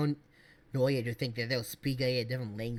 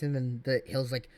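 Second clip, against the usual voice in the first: ordinary talk, nothing marked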